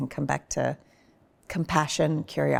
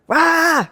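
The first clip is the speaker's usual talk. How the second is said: sharp high loud roar; quick roar; 11 semitones higher, 8 dB louder than usual. Shown as a demonstration. Showing husband how fights end now, quick roar. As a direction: burst out a short roar. Demonstrating quick anger discharge.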